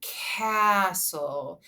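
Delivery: CASTLE stretched out, vowel and S held long; long, drawn-out Castle; really slow. Shown as a demonstration. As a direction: say Castle in slow motion for a child to copy. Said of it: "say the word castle with me really slowly".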